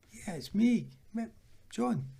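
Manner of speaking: put-on toned-down Scottish accent. Teammate reintroducing himself to footballer, imitated Scottish accent. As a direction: insist on being recognised, in a light Scottish accent. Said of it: "I'll not do the full accent".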